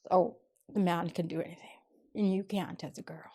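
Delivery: whispered mocking quote of a sexist saying. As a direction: breathe it out as a sneering aside.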